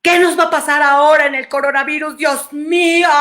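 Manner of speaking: imitating someone frightened by the pandemic; anxious worry. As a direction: panic a little about what comes next. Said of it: "Pongo un ejemplo".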